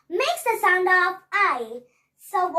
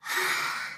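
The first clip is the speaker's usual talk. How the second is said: long breathy exhale; breathy sigh; 7 dB quieter than usual. Shown as a demonstration. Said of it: "she made a big sound like this".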